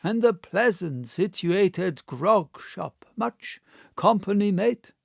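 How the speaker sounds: a hint of a rough old captain asking; gruff old seaman. Old seaman asks the innkeeper about company, lightly gruff. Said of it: "called roughly".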